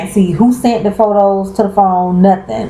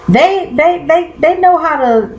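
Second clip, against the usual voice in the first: excited; 10 semitones higher than usual. Real, not acted. Praising the show's writers.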